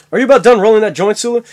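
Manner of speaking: captain's command. Acted. Captain hurries Sulu with the joint, commanding. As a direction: bark it out like a starship captain. Comic Star Trek sketch, captain calling crew.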